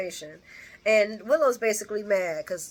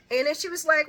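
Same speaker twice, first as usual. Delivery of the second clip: ordinary talk, nothing marked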